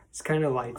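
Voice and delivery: ordinary delivery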